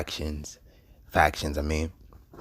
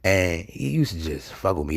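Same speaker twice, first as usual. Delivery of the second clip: ordinary talk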